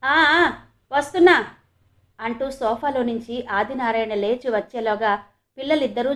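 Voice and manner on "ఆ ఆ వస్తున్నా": higher, louder answering call; calling back. A narrator's reading. Husband answers his wife from the sofa, calling back. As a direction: holler back to the caller.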